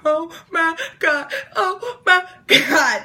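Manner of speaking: high, slow, broken-up wailing; hammed-up tearful meltdown; mock sobbing. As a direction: sob out each word, imitating a crying fit. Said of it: "And I was crying".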